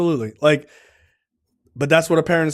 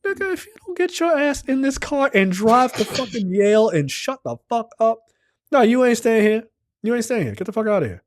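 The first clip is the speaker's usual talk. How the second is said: high, far-off voiced mom telling him off; a mom shoving her son out to Yale; hammed-up scolding mother; fierce mother; 5 semitones higher than usual. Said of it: "His mom was like".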